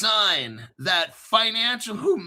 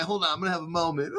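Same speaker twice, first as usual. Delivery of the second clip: fast, half-laughing fake crying; laughing mock tears; much faster than usual. Real, not acted. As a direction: pretend to get emotional, laughing through it. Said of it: "I'm definitely gonna cry".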